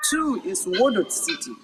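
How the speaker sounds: usual speaking voice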